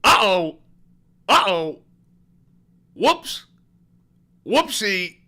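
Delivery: loud sing-song oops; comic oops. Real, not acted. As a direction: ham up the embarrassment. Comic embarrassment after blunder.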